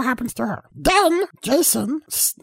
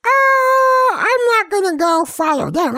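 mock Jason; 5 semitones higher than usual. Jason refusing to follow the couple, mock shout. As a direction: blurt it out as a goofy killer. Jason voiced in a retold story.

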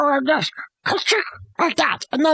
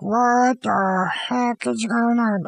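drawn-out bewilderment; 5 semitones lower, much slower than usual. Retold or imitated. Acts out victim's bewilderment.

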